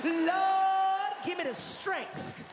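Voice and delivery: hammed-up plea for strength; woman praying so she won't lose her temper; mock prayer.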